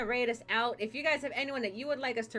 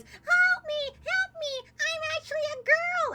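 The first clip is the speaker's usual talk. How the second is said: very high squeaky cry for help; squeaky frog plea; 5 dB louder than usual. Acted. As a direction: squeak like a tiny frog begging. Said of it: "meets up with the prince and says".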